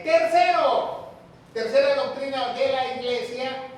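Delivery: loud, high, drawn-out opening word; emphatic announcement. Real, not acted. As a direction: proclaim the next heading to the congregation. Preacher announces the third doctrine.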